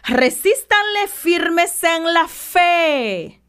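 Resístanle hit hard, then every word slow; very slow, emphatic. Shown as a demonstration. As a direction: drag out the whole verse for weight. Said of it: "Oiga bien". (muy lento y enfático, recalcando Resístanle)

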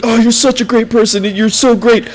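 high-pitched mock gushing, no accent; gushing praise. Coach imitating drunk gymnast praising a rival, gushing. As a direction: lay on the drunken affection.

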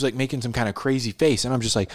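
normal talking voice

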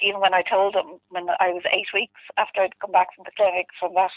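ordinary talk, nothing marked

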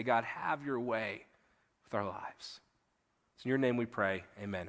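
low, soft, unhurried praying voice; prayer. Real, not acted. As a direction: pray quietly and earnestly to God. Leads the congregation in prayer.

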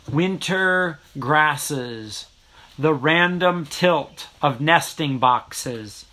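very slow, sparse haiku reading; hushed sparse haiku. Acted. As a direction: leave long silences between lines. Recites own haiku slowly.

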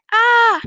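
short loud, high "Ah!"; cry of pain. A narrator's reading. Boy stumbles in the woods, loud cry of pain. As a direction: call out a pained "Ah!". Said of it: "roept hij".

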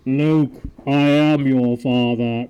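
slow, loud Vader voice through a cup; wrong Vader line. Shown as a demonstration. Host showing the misquote as Vader, slow and loud. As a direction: boom it out slowly like Vader. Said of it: "That's the wrong".

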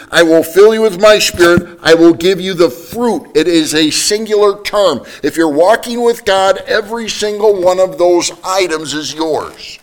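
high, loud and driving; preaching at full force. Acted. Preacher urging the church to walk with God, loud and high. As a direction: lift the congregation with rising fire.

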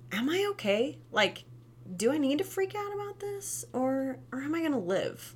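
put-on child's inner voice. Voicing child after fall.

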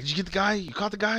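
imitating an urgent questioner, pitch climbing; retold eager questioning. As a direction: act out someone eagerly asking for news. Retelling Leland's anxious questions to investigators.

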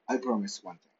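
ordinary delivery

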